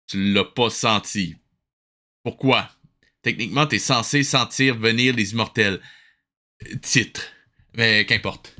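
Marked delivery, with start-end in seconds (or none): none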